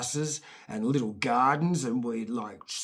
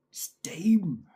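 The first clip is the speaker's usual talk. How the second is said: whisper; 4 semitones higher than usual. Retold or imitated. Puzzled kid wondering aloud.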